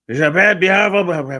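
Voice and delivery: mocking bellow of a slogan; mock rally cry. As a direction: belt out "beautiful" like a mocking crowd-pleaser. Mocking the big beautiful bill.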